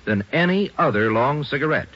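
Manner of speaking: ordinary delivery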